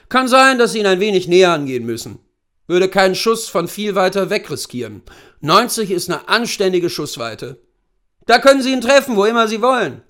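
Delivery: put-on brief the client as an old hunting guide; erfahrener Großwildjäger. Hunter instructing his client. (erfahrener Jagdführer, lauter und höher)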